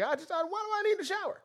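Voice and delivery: squeaky high mock voice. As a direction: squeal it like a clueless dude. Mocking people who never shower.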